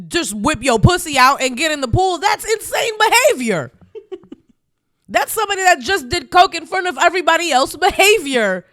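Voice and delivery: high, incredulous rant with laughter; amused outrage. Real, not acted. Co-host reacting to wild pool behavior, amused outrage. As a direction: build to a high, scandalized punch on the last word.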